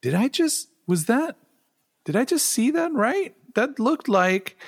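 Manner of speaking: retelling a jaw-drop moment, pitch climbing high; stunned double take. Retold or imitated. Retelling to cohost spotting an actor, high incredulous surprise. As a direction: voice shooting way up in disbelief. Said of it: "where I was like".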